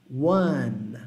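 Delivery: "one" slow, holding the N; one com N final. Shown as a demonstration. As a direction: stretch "one" onto a tongue-tip N. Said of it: "Deixa a sua língua lá no N". ("one" alongando o N, língua no céu da boca)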